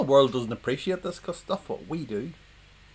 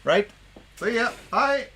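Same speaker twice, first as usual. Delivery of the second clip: high, sing-song goodbye; cheery goodbye; 11 semitones higher, 5 dB louder than usual. Pretend. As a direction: chirp bye as you leave. Mock walk-off.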